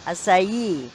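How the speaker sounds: "Acai" modelled as ah-sigh-EE; "Acai" in three syllables for a learner to repeat; correct pronunciation. Shown as a demonstration. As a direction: say "Acai" slowly enough to hear the final ee. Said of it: "it should be pronounced like".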